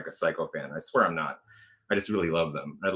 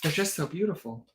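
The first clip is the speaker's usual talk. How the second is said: put-on higher, dreamy little-kid voice; six-year-old, higher; 8 semitones higher, 4 dB quieter than usual. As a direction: echo the child's awe, a bit higher. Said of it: "imagine, like, a six-year-old saying that".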